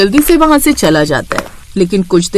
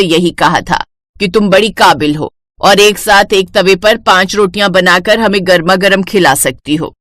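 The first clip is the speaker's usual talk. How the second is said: low, bossy mother-in-law voice; a domineering saas giving orders; lower-pitched strict older woman; stern mother-in-law; 4 semitones lower than usual. Acted.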